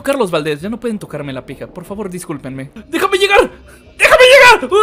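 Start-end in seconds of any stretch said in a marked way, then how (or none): 2.9-4.7 high yell, growing louder; desperate shouting. Real, not acted. Streamer pleads with the game to let him through, screaming. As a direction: beg at full volume, escalating.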